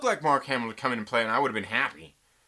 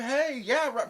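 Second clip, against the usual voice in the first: mock reply; 8 semitones higher than usual. Mocking voice of Sebastian Stan.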